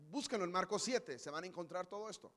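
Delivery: normal talking voice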